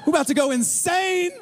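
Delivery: high-pitched, riled-up crowd voice; retold fans yelling. Rival fans about to rush the court, mock yelling. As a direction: imitate worked-up fans without a full shout. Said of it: "They start yelling".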